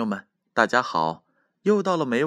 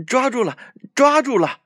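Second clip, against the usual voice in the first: much higher, louder bouncing joy; excited; 6 dB louder than usual. A narrator's reading. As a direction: cheer it while hopping. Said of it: "蹦蹦跳跳地说".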